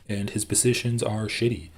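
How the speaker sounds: ordinary talk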